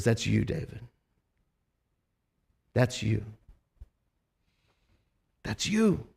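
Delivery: soft, grave, pointed; hushed accusation. Retold or imitated. Nathan quietly confronting King David with his guilt, hushed and grave. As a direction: drop the voice and let each repeat land.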